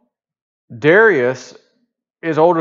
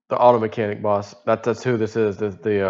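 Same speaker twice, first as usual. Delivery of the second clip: usual speaking voice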